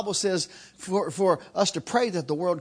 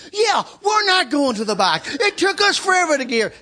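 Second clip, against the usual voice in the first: loud, higher rallying cry; crowd shouting back; 8 semitones higher, 5 dB louder than usual. Retold or imitated. People behind him shouting agreement, loud. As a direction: chant it like an angry queue.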